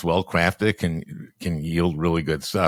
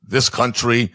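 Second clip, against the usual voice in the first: raised, louder Brooklyn growl of Bernie Sanders; Bernie Sanders impression; 5 semitones higher, 3 dB louder than usual. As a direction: rant about billionaires like Bernie Sanders. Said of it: "You know, I can do".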